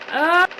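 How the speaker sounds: short loud dying scream; death scream. Shown as a demonstration. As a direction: yell it like a dying spy. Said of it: "That one spy death scream".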